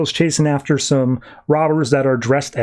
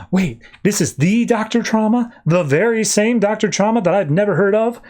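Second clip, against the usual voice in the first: higher-pitched feigned awe; mock amazement; 7 semitones higher than usual. Batman mocks Doctor Trauma's fame, feigned amazement. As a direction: overplay the astonishment, pitched up.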